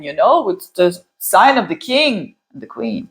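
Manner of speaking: ordinary delivery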